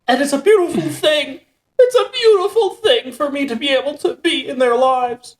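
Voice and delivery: high-pitched, tearful mock voice; Jordan Peterson. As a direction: squeak it out as if choking back tears. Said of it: "and then Jordan Peterson's like".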